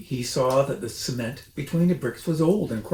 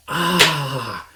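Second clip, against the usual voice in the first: loud gasping ah; 2 semitones higher, 6 dB louder than usual. A narrator's reading. The smallest fox gasps at the fiery cider. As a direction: gasp at the burn. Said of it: "gasped the smallest fox".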